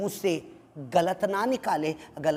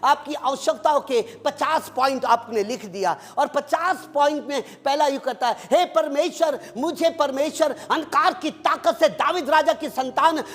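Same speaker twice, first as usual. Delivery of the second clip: raised, ringing sermon voice; full-force preaching; 7 semitones higher, 3 dB louder than usual. Acted. Pastor urging the congregation, preaching at full force. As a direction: preach it out to the whole church.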